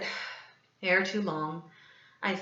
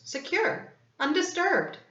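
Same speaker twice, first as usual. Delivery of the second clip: smug boast; 10 semitones higher than usual. A narrator's reading.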